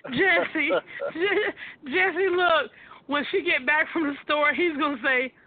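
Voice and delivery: giggling through the sentence; laughing. Real, not acted. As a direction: try to get it out while cracking up. Host laughing while talking.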